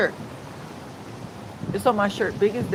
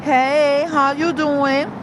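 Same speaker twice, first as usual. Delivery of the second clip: higher, slow, drawn-out "how you doing?"; slow, sing-song talk-show hello, pitched up; Wendy Williams greeting; 5 semitones higher, much slower than usual. Retold or imitated. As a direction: stretch it out like a daytime talk host greeting her audience. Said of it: "How you doing?" like that, 'cause Wendy Williams".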